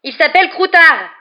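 high, loud outraged shout; indignant full-voiced cry; yelled. A narrator's reading. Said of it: "hurla Ron".

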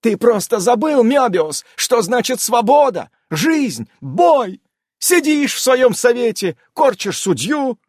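high, loud furious tirade. A narrator's reading. Enraged amazon scolding the old keeper, high and loud. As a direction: hurl it at him in a rage. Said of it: "разъярилась".

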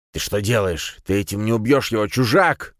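alarmed, fast rising shout. Acted. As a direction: yell at him in alarm. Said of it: "крикнул побледневший старик".

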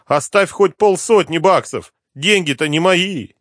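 high, whining plea; plaintive begging. A narrator's reading. Addict begs the inspector to leave some money, plaintive. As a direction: plead pitifully for some of it. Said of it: "жалобно простонал наркоман".

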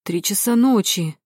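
plain reading voice

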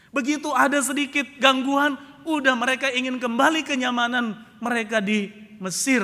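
loud, high, declamatory sermon; forceful preaching. Real, not acted. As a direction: proclaim it to the congregation at full force. (berkhotbah lantang dan berapi-api)

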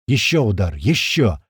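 ordinary delivery

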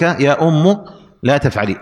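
"أم" said ummu; final damma. Shown as a demonstration. Teacher repeating the example, "أم" said ummu. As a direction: end "أم" on a short u. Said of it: "وضم الحرف الذي كان مكسورا".

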